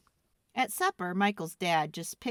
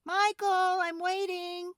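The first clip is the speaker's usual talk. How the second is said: higher, calling down the stairs; mother calling; 13 semitones higher, slower than usual. Acted. Mother calling Michael from upstairs, higher. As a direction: call him up like a mom. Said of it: "his mother called".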